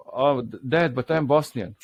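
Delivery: ordinary delivery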